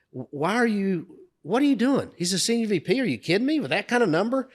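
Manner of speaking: high-pitched incredulous dressing-down; mock-outraged scolding questions; retold berating. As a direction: imitate a boss tearing into someone, pitch jumping up. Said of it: "he's berating me".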